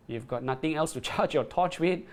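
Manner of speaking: usual speaking voice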